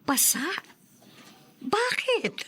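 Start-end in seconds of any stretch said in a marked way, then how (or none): 1.6-2.3 surprised. Acted. Mother shocked by news of bruises.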